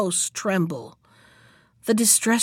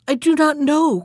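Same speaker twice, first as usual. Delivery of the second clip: higher-pitched, mildly fearful denial; frightened cry; 6 semitones higher, 4 dB louder than usual. A narrator's reading. Poor wife answering her husband about the key, frightened cry. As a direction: cry it out as a frightened woman, understated. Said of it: "cried the poor woman, paler than death".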